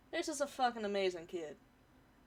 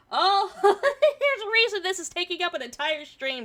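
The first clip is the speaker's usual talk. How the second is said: laughing; 7 semitones higher, 9 dB louder than usual. Real, not acted. Laughs at the post.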